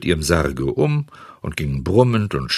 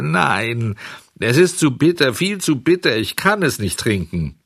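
put-on high, plaintive puppet-boy protest; high, insistent refusal; whining child refusing; 6 semitones higher than usual. A narrator's reading. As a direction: balk like a child at bitter medicine.

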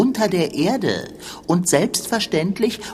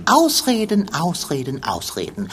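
dismissive chant trailing down; scornful, fading. Acted. Dismissing excuses in argument.

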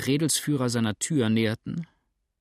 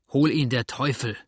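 angry call; 2 semitones higher, 4 dB louder than usual. Acted. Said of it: "rief der Kerl".

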